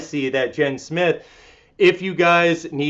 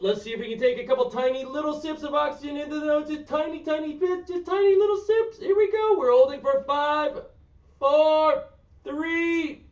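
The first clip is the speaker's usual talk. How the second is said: playful high voice; 12 semitones higher, 6 dB quieter than usual. Acted. Playful high cue for tiny sips.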